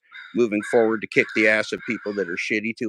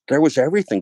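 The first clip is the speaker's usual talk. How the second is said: 1 building excitement; 8 semitones higher, 5 dB louder, faster than usual. Real, not acted. Building list climax.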